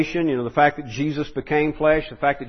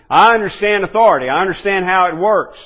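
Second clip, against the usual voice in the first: louder, higher, firm conviction; emphatic centurion; 4 semitones higher, 7 dB louder than usual. Acted. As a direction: speak as a confident officer.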